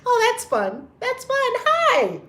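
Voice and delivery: high delighted squeal. Real, not acted. Spotting fun live streams, squealing high.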